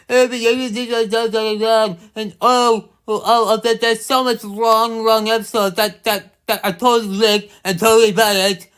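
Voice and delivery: high whiny voice mimicking a nagging commenter; whiny mock critic. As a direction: parody the fans, stammering and whining. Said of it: "before I start saying".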